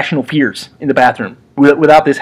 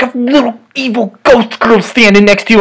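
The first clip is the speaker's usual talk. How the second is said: normal talking voice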